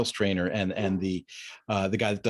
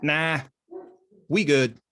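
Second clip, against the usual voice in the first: dismissive brush-off; 4 semitones higher, slower than usual. Retold or imitated. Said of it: "He just goes".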